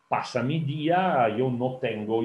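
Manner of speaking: normal talking voice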